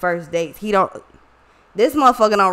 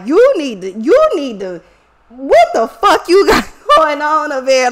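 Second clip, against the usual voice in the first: louder, higher, half-laughing disbelief at him; exasperated yelling; 5 semitones higher, 7 dB louder than usual. Retold or imitated. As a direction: call him out, loud and incredulous. Confronting relative at the hospital.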